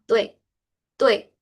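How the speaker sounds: "tui" with a hint of E slipped in before the I, like tuei; teacher modelling "tui" for students; correct pronunciation. Shown as a demonstration. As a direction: say "tui" gliding through a small E. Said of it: "metí por ahí el sonido de una E".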